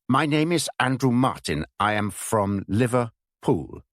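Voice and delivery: put-on German accent, Liverpool split as Liver pool with a long gap between; German-accented password. Spy giving the password to his contact, German accent, Liverpool broken into two words. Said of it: "Liverpool as if it were two words".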